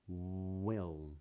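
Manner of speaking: "Will" with a long oo-like W at the start; rounded W. Shown as a demonstration. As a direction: linger on rounded lips, then open into "Will". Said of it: "try that in these words".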